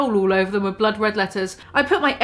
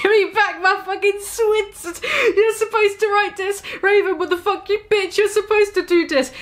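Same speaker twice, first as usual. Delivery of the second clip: high, indignant ranting at a friend; mock outrage; 9 semitones higher than usual. Author yelling at her friend Raven, high mock outrage. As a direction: squeal it out like an offended teen author.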